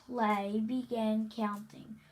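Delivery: ordinary delivery, nothing marked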